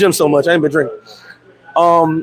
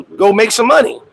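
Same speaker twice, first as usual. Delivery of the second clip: ordinary talk, nothing marked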